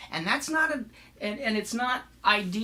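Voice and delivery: ordinary delivery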